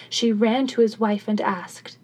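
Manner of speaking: ordinary delivery, nothing marked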